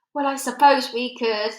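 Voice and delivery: grudgingly. A narrator's reading. Air Force chief grudgingly agreeing to the Queen. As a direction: give in unwillingly. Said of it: "admitted grudgingly".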